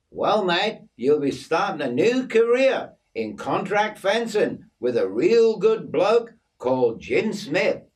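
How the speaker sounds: lively story-character voice; cheery Aussie mate. Acted. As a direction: play the chummy Aussie bearing good news.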